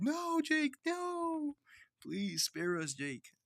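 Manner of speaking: high, drawn-out mock plea; hammed-up high-pitched begging; pleading victim. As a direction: beg Jake for mercy in a high little voice. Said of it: "people mumbling in the back".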